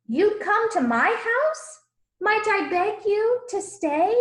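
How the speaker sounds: high, disbelieving question; can't believe his luck, pitch soaring; amazed. Acted. Zacchaeus astonished that Jesus will visit, high and amazed.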